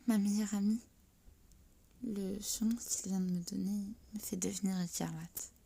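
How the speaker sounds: ordinary delivery, nothing marked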